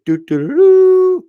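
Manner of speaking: hummed bugle fanfare, high; trumpet fanfare. Pretend. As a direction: doo doo like a bugle.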